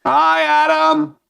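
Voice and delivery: very high, drawn-out hello; squeaky, slow mock greeting; playful greeting. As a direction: sing out a greeting in falsetto. Playful greeting to a missed host.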